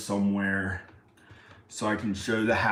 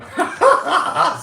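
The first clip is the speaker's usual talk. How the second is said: high shrieking laugh; 11 dB louder than usual. Real, not acted. Laughing at himself.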